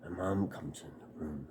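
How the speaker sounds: usual speaking voice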